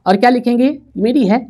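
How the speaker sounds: usual speaking voice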